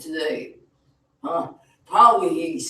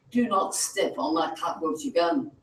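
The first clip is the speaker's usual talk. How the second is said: ordinary talk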